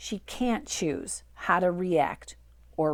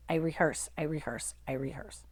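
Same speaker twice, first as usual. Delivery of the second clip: each repeat softer, trailing off to almost nothing; fading repetition; 4 semitones lower, 9 dB quieter than usual. Real, not acted. Host reveals her secret, fading out. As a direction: let it fade away like an echo.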